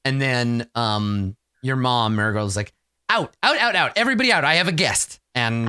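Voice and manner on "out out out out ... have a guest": put-on shooing the kids out. Mother shoos kids out.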